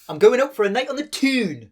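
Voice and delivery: high, sing-song Geordie; lilting Geordie boast; Geordie accent. Shown as a demonstration.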